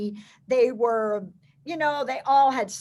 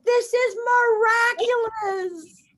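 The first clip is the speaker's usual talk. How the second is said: awed; 10 semitones higher, 4 dB louder, much slower than usual. Retold or imitated. Awed remark on way home.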